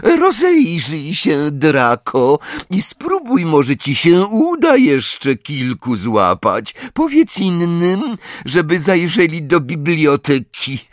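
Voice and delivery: high, gleeful, triumphant voice; triumphant Umbridge. A narrator's reading. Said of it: "szczerząc zęby w triumfalnym uśmiechu".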